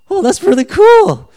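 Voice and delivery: high, loud gushing reaction; squealed mock awe; hammed-up amazement. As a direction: blurt it out with over-the-top delight. Acting out his amazed reaction.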